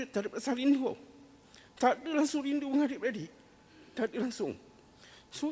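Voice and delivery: fighting back tears while he speaks; voice breaking. Real, not acted. Recalling dead parents, remorse. (suara sebak, hampir menangis)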